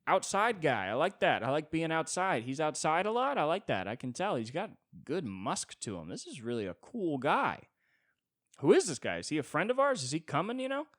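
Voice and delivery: high, loud, eager dog chatter; excited dog voice. Acted. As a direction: gush like a happy dog. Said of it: "the dog's just sitting there like".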